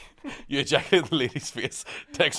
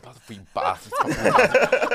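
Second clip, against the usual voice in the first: mum's angry rant, laughing. Retold or imitated. Retelling mum swearing at the bus lads, laughing yell. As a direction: curse the boys again, breaking into laughter. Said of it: "She's like".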